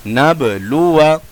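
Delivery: नबलुआ with short final अ; measured नबलुआ, alif silent; correct recitation. Shown as a demonstration. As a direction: keep the last vowel of नबलुआ brief. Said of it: "अलिफ नहीं पढ़ा जाएगा".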